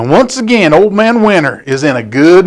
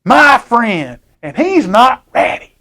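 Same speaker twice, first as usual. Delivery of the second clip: put-on higher, drawn-out child voice sticking up for her friend; defensive little girl; 7 semitones higher than usual. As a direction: protest like a loyal little girl. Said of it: "This girl's like".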